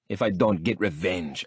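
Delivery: ordinary delivery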